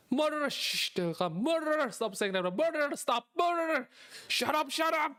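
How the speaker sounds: high, panicked pleading to shut up; frantic hushing. Acted. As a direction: hush him desperately before they come. Begging him to stop saying murderer.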